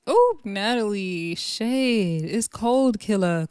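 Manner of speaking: normal talking voice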